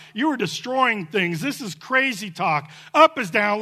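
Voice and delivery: usual speaking voice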